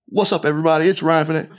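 mock hype-man show opener; Ryan Pineda impression. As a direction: lightly imitate a pumped-up channel host opening. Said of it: "watched a ton of Ryan Pineda content".

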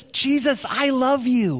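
overly sweet, drawn-out love declaration; lightly voiced, sentimental retelling of a storyteller's version; mock tender. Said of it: "And the Spirit would say to Jesus".

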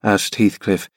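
ordinary talk

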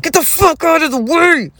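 imitating her own furious shout behind the wheel; road-rage shout. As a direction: scream it at the car hogging the passing lane. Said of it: "I am angry as fuck".

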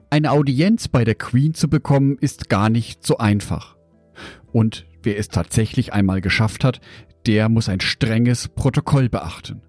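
ordinary talk

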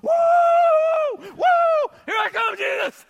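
loud, high whooping shout; high shouted joy; excited whoops. Acted. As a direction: whoop like an overjoyed fisherman leaping overboard. Peter jumping out excitedly.

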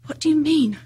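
a restrained cry of alarm. A narrator's reading.

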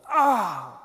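sharp loud pained yelp; short retold gasp of hurt; sudden cry of pain. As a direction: cry out as if you just hit the pool edge. Slipping and falling by pool.

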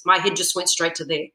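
ordinary talk

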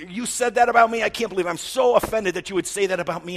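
high, loud, hammed-up offended whine; mock outrage. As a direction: overplay the wounded pride. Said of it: "I mean, we".

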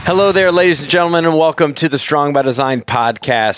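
bright podcast host greeting; radio host welcome. Acted. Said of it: "host opening the show".

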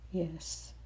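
ordinary delivery

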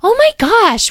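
very high, loud gasp of wonder; amazed. A narrator's reading. Excited guest amazed by flowers down here. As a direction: squeal it in astonishment.

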